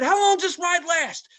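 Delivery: high, loud, demanding question; angry call from the ride; retold yell. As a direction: shout the question at the worker. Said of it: "I was just yelling".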